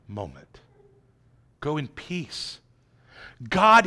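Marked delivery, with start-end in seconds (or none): 1.6-2.6 gentle. Shown as a demonstration.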